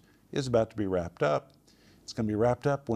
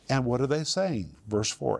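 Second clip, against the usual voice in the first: ordinary talk, nothing marked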